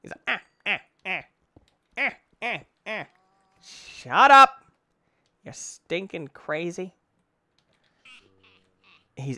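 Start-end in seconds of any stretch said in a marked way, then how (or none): 0.3-3.1 high, short "ah" bursts; imitated mocking laugh. Player imitating the creature laughing at them, mocking staccato laugh. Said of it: "He's laughing at me". 3.6-4.6 loud, high outburst; exasperated yell. Real, not acted. Player yelling at the creature laughing at them, exasperated.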